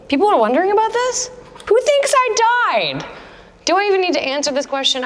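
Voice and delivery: high, fast disbelief at a silly question; incredulous. Real, not acted. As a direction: act amazed anyone could think that. Reacting to rumor of own death.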